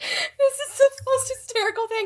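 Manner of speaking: crying with laughter; high, breaking laugh-cry; laugh-sobbing. Real, not acted. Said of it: "I'm, like, sobbing".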